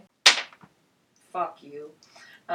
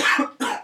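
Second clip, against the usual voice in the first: a real harsh cough; coughing fit. Real, not acted. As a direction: cough hard, sick. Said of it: "I'm coughing quite a lot".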